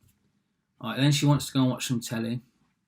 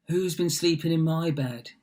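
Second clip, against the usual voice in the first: higher put-on bear voice; storybook bears; 5 semitones higher than usual. Bears finding Goldilocks in bed, put-on higher voice. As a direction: play the bears in a raised storytelling voice. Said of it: "And they're like".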